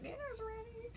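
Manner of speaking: high, soft sing-song dinner call; lightly voiced housewife announcing dinner; Samantha calling dinner. Samantha sweetly calls the zombies to dinner, high and soft. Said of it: "She's like".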